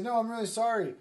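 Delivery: high, loud mock apology; hammed-up contrition; pleading apology. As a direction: plead emphatically for forgiveness. Joseph's acted apology.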